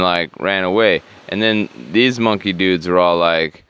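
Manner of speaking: normal talking voice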